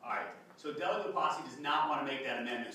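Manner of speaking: normal talking voice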